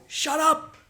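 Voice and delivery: high loud "Shut up". Retold or imitated. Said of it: "I'd go".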